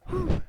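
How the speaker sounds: big puff of breath; real relieved whew; loud exhaled whew. Real, not acted. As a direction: blow out a loud breath mid-recap. Catching breath after rapid summary.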